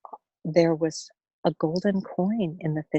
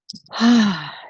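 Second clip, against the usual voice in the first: long loud exhale of relief; deep sigh of relief; 3 semitones higher, 5 dB louder than usual. Acted. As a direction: let all the worry out in one sigh. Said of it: "She breathed a deep sigh of relief".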